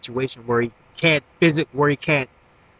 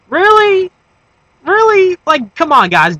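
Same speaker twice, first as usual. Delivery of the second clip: much higher and louder, incredulous Really; exasperated disbelief; 8 dB louder, slower than usual. Retold or imitated. Fan mocking an obvious Batman copy, loud high incredulity. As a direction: throw up your hands at the screen.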